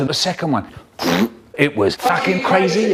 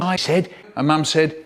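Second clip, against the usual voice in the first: usual speaking voice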